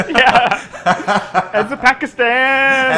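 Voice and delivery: giggling while speaking; laughing. Real, not acted. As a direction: chuckle the punchline out. Laughing joke.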